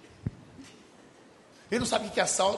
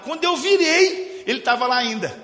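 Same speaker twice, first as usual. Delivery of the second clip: dramatic reveal; 5 semitones higher, 7 dB louder than usual. Acted. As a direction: stretch out the turn, then hit the reveal. Suspense reveal in story. (revelação dramática, alta e lenta)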